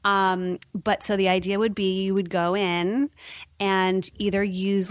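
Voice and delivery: normal talking voice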